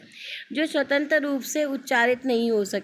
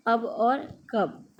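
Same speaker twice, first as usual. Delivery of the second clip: each word read out slowly and clearly; slow example words; slower than usual. Shown as a demonstration. Teacher reading sample words to young students, slow and clear. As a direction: spell out अब and कब slowly for the class. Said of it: "बिना मात्राओं के शब्द".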